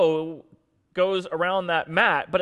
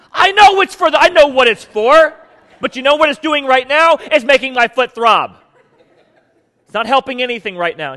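loud, much higher furious rant; yelling angrily; 8 semitones higher, 12 dB louder than usual. Retold or imitated. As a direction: rant at your wife with a throbbing foot. Husband yelling at wife over tray.

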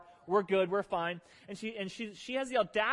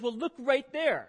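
higher, slow pointed reply; wife's mildly higher pointed retort; wife pointing out; 6 semitones higher, 4 dB louder, slower than usual. Retold or imitated. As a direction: slowly show him the sign. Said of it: "She goes".

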